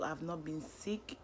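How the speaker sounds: normal talking voice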